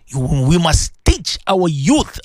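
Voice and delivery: normal talking voice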